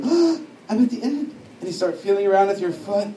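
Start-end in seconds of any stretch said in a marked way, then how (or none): 0.0-1.3 high, startled realization; mock fright at the cliff edge; higher, gasping alarm; acted alarm.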